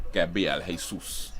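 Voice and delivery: "Jesus" said slowly as hay-SOOS; slow "Jesus" with a soos ending; Latin pronunciation. Shown as a demonstration. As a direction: say "Jesus" with an H, drawing it out. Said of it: "that's definitely Jesus".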